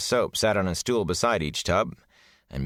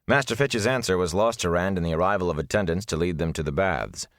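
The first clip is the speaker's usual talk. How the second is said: ordinary delivery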